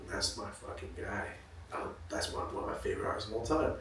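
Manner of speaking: very soft, reverent murmur; hushed fan awe. Retold or imitated. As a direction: say it quietly to yourself in awe. Retells childhood awe of idol.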